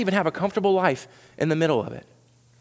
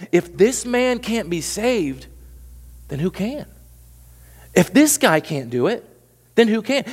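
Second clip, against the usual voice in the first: incredulous disciples; 6 semitones higher, slower than usual. Acted. Disciples voiced in sermon.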